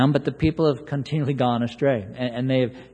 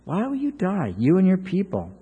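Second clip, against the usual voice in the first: pleading prophet; 5 semitones higher than usual. Retold or imitated. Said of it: "he says to him here".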